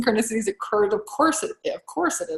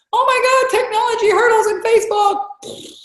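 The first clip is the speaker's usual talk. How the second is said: hammed-up panicked shout, much higher and louder; mock panic; 5 dB louder than usual. Coach imitating a terrified client, mock panicked shout. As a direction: play a client freaking out over Facebook tech. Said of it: "it might be".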